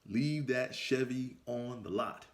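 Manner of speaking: each word laid down slowly, Leave leaned on; slow and deliberate. Shown as a demonstration. Warning his younger self, slow and deliberate. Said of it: "Let me say that again".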